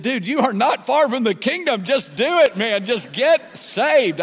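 high, loud, insistent plea; emphatic urging. Retold or imitated. Preacher retelling his plea to the driver, urgently exhorting.

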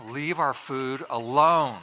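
slow, deliberate mock command; emphatic plea. Preacher telling wives about husbands' food, slow mock plea. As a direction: space each word out for comic weight.

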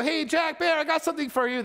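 high, bright call across the house; calling out to kids. Retold or imitated. A dad calls his two boys, high and calling out.

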